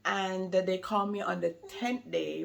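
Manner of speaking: ordinary talk, nothing marked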